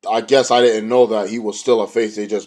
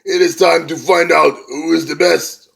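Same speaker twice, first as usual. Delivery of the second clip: loud, high Scottish wrestler promo; badly faked Scottish accent, declaiming; attempted Scottish accent; 6 semitones higher, 4 dB louder than usual. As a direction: put on a rough Scottish accent for a big challenge. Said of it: "I can't do the Scotland accent".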